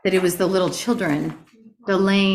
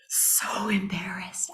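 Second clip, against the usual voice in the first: whispered, ashamed; embarrassed whisper; 9 dB quieter, much slower than usual. Retold or imitated. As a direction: breathe it out as if mortified. Retelling her embarrassment after singing.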